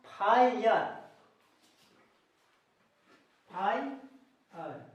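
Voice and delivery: फायर said slowly as "faa-yar"; model reading of फायर. Shown as a demonstration. Teacher reads out what is written, slow model pronunciation of फायर. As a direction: stretch फायर into "faai... ar". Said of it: "मैंने क्या लिखा".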